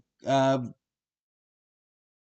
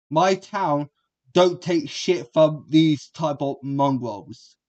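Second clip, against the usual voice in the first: ordinary delivery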